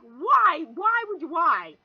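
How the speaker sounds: high, loud, pleading why; shocked loud why. Retold or imitated. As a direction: demand an answer, startled. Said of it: "I looked at him like".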